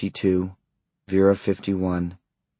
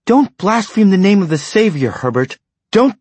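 sharp, much higher and louder rebuke; indignant rebuke; 12 semitones higher, 6 dB louder than usual. A narrator's reading. Wife rebuking her husband for his blasphemy, indignant. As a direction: scold him hard for the blasphemy.

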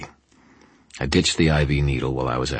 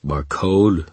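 louder, higher sneer; 4 semitones higher, 5 dB louder than usual. A narrator's reading. Henchman scorning a mobster's name, sneering. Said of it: "with a sneer in his voice".

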